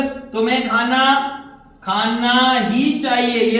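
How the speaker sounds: "खाना ही चाहिए" very slowly, pressing on "ही"; slow stressed demo. Shown as a demonstration. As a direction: drag it out slowly and lean hard on "ही". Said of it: "खाना खाते नहीं हो आजकल". (बहुत धीरे-धीरे, "ही" पर ज़ोर देते हुए)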